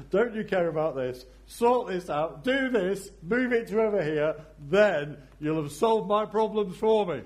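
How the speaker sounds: high, loud, bossy demands to God; frantic demanding. Acted. A believer bossing God to sort out her problems. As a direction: order God to fix it all. Playing herself complaining to God.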